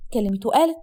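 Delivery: plain reading voice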